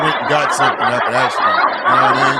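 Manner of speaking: laughing. Real, not acted. Laughing while explaining setup.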